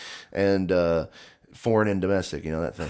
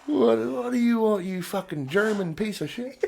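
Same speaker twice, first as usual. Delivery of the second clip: high, mock-tough barfly sneer, fading into a laugh; drunk bar taunt; 10 semitones higher, 4 dB quieter than usual. Drunk friend picking a fight in a bar, high sneering taunt.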